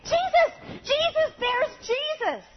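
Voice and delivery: put-on high, loud, ecstatic child screaming; little girl shouting with joy; child's excited scream. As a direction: shriek it like a thrilled toddler.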